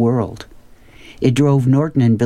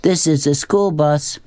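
higher-pitched, lightly called challenge; calling out; 4 semitones higher than usual. Acted. Norton challenges the girl in the dress, mild call-out. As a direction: call it down the aisle without yelling. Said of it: "Norton called".

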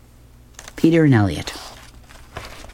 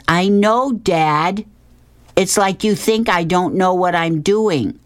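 high-pitched, exasperated son; Elliot; 8 semitones higher, slower than usual. Acted. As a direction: protest to Dad in a raised kid voice. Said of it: "Elliot says".

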